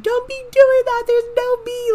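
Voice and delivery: put-on very high, loud, squeaky kid yell; imitated little brother's scream. Little cousin yelling back at the teasing girls, squeaky angry scream. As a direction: screech it like an angry little boy. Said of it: "he'd scream too".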